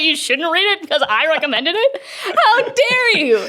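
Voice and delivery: playful fake fury at a friend; mock outrage.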